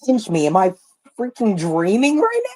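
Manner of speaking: normal talking voice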